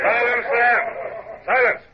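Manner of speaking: barked order to hush the deck, high and drawn out; commanding call for silence. Acted. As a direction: silence the crew with one stretched command. Captain silencing cheering crew.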